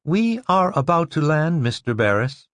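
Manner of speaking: calm, polite ship announcement; pleasant recorded voice. Acted. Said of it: "said pleasantly".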